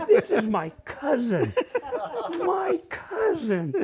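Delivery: laughing through the words, slowing down. Real, not acted. Realizing the pretty girl at college is his cousin, laughing slowly. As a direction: laugh at the shock of it, dragging it out.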